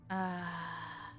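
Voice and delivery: ordinary delivery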